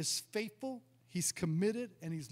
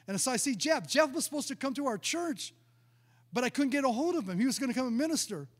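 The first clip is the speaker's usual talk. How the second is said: ordinary talk, nothing marked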